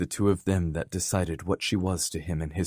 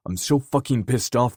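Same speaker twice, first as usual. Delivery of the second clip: raised, heated voice, fury boiling over; angry outburst; 6 semitones higher, 5 dB louder than usual. Acted. Dare venting his rage to Kenzie, loud angry voice. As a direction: spit it out hot and loud. Dare furious after the attack.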